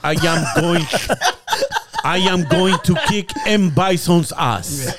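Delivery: lightly put-on Belgian action-hero accent; Van Damme impression. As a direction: drag it out like a bad Van Damme. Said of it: "he talks like this".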